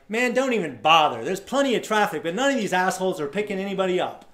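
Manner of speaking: loud, high, fed-up griping; bitter hitchhiker rant. Retold or imitated. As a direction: blow off steam at the road.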